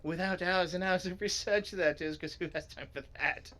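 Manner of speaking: high know-it-all explainer voice; eager story character. Acted. Reader as a character explaining the library, high voice. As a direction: start high and keen, easing down.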